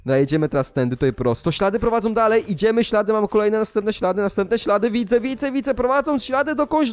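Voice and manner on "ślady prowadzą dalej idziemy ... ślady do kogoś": breathless-style chase, rising higher and higher; mounting excitement. Acted. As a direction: build suspense toward the reveal. Excitedly following trail to a culprit.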